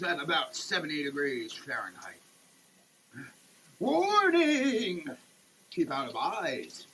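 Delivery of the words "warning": mock alarm. Reading label warning dramatically.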